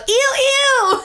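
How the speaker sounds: high squeal of disgust. Retold or imitated. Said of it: "you hear Courtney go".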